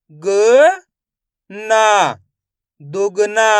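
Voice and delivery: loud separate syllables, then blend the word; syllable by syllable phonics. Shown as a demonstration. Teacher sounds out syllables loudly for the class. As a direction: sound out each syllable for young learners.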